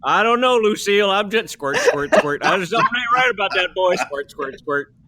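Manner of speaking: put-on mumbling old farmer voice, laughing through it; muttering farmer. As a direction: grumble to yourself while milking. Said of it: "muttering into the udders".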